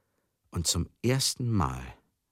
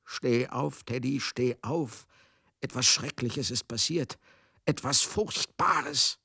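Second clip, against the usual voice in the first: a touch of panicked pleading, rising higher; mild mock pleading; 6 semitones higher, slower than usual. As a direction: lightly voice a desperate woman crying out. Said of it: "einhämmert und schreit und schluchzt". (leicht nachgeahmtes, verzweifeltes Flehen)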